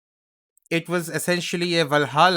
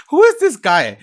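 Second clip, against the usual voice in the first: laughing; 9 semitones higher, 7 dB louder than usual. Real, not acted. Laughing at the clip.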